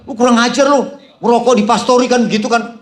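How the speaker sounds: loud, high mock rebuke of a guest; hammed-up angry pastor telling off a smoker; pretend angry scolding. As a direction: scold as if losing your temper, half in play. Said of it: "Kalau saya mau emosi".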